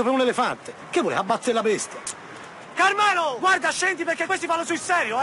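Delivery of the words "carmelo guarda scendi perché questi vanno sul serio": high, urgent call upward. Acted. Pleading with a man on the roof, urgent calling. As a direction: call up to warn him.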